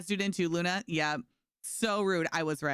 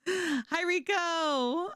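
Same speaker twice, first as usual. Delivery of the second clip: cheery greeting; 11 semitones higher, much slower than usual. Real, not acted. Greeting a chatter.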